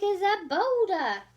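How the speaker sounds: awed outburst. Acted. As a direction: burst out at the sheer size.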